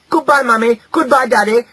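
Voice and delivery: put-on high, loud children's sing-song farewell; cheerful little ones all calling at once; chorused goodbye. A narrator's reading. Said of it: "chorused the six good hedge piglets".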